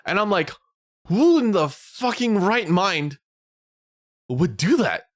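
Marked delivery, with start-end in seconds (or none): 1.1-5.0 high, slow, disbelieving rant; incredulous outrage. Retold or imitated. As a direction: stretch out the outrage at the absurd offer. Outraged at coin flip offers.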